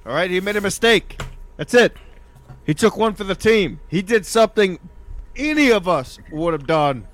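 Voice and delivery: high, loud sermon about sin; preacher voice. Acted. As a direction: preach it like a pastor. Mock preaching about Derek.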